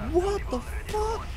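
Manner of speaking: very high, drawn-out mock shock; slow squeal of horrified disbelief. Horrified reaction to film cannibalism.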